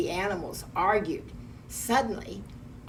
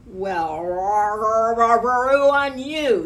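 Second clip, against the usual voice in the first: roaring shout, building higher and louder; yelling giant; 4 semitones higher, 9 dB louder, slower than usual. A narrator's reading. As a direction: build into a huge drawn-out roar. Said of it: "he yelled".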